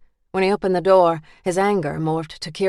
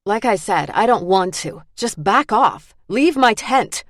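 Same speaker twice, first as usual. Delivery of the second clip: loud, rising angry dismissal; 4 semitones higher, 4 dB louder than usual. Acted. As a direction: snap it out, building loud. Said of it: "The last words were loud and tinged with so much anger".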